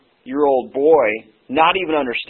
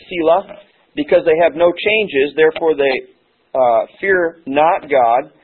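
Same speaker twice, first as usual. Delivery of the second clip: raised, measured Bible reading; scripture reading; 3 semitones higher than usual. Acted. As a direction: read the verse out to the congregation. Reads scripture passage aloud.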